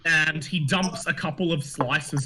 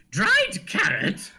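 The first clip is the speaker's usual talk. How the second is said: high, slow baffled question; puzzled gnome; much slower than usual. Acted. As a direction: squeak out the surprise. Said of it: "he says".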